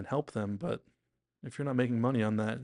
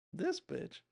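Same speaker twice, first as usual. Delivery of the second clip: higher, hushed incredulous aside; mock disbelief; 7 semitones higher, 3 dB quieter than usual. Deborah's face reacting to the idea, incredulous. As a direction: voice a look of disbelief.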